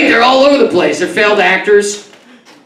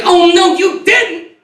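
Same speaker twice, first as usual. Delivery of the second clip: put-on high, slow sassy girl voice; sassy city girl; 10 semitones higher, much slower than usual. Comic imitates the city girl whose foot he stepped on, high slow sass. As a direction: draw it out, mock outraged. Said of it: "She's like".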